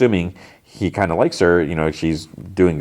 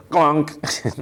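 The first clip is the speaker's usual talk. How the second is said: club thunk sound; 9 semitones higher than usual. Retold or imitated. Retelling the show to a friend, mimicking a club hit with a high "gonk". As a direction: toss off the sound of the club landing. Billy club hits officer.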